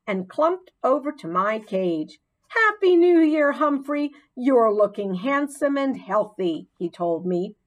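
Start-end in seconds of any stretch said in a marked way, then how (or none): 2.5-6.7 1 hearty janitor greeting. A narrator's reading.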